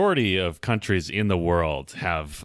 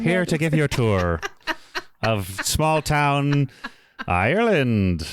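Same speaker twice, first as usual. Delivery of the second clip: put-on Irish accent; 4 semitones higher, slower than usual. Playing Ned giving tour.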